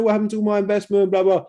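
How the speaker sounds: high, whining mock wail of a burned investor; hammed-up high whine of a panicked coin holder; whiny losing investor. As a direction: moan it like a crying buyer who lost everything. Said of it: "People start complaining and crying, going".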